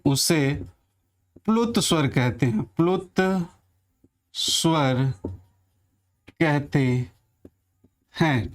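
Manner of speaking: word by word with long pauses; slow dictation. Shown as a demonstration. Teacher finishing the dictated definition slowly. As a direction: let them write each word. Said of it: "मैं पहले परिभाषा लिख दे रहा हूँ".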